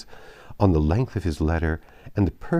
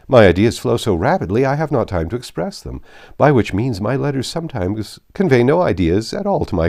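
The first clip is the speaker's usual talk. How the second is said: louder, higher, laughing at his own faults; cheerful self-mockery; 4 semitones higher, 8 dB louder than usual. Bingley joking to the company about his letters, loud and merry.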